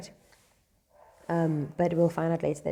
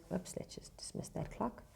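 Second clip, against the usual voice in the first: whisper; 3 semitones higher, 12 dB quieter than usual. Real, not acted. Aside while dismissing an alarm.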